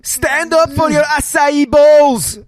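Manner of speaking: high, loud announcer-style holler; rally a crowd at full volume; drawn-out rousing yell; mock hype shout.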